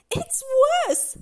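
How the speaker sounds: high, loud lament; unhappy. A narrator's reading. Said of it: "said Mister Happy unhappily".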